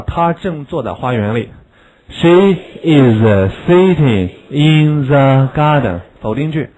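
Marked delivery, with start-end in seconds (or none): 2.1-6.0 slow, clear classroom model reading; slow model sentence. Shown as a demonstration. Teacher modelling a sentence slowly for pupils.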